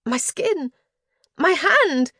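high, rising cries of pain and alarm. A narrator's reading. Narrator in sudden burning pain.

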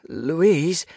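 high, loud call falling at the end. A narrator's reading. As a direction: call out urgently for your friend. Calling again, louder, for friend.

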